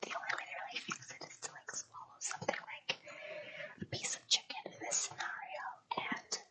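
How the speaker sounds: very soft, voiceless froggy rasp; raspy, froggy throat. Real, not acted. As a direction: let the voice fail into a raspy near-whisper. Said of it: "really raspy and really froggy like this".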